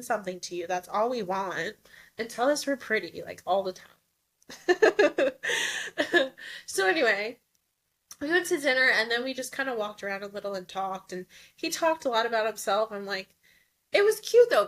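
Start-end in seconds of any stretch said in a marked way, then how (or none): 4.5-6.6 bursting laugh. Real, not acted. Laughing after joke. 13.9-14.7 gushing. Real, not acted. Gushing about his accent.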